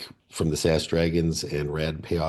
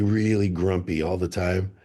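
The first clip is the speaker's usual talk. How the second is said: ordinary delivery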